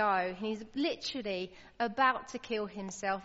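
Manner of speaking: usual speaking voice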